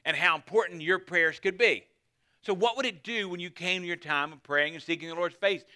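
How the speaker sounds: ordinary talk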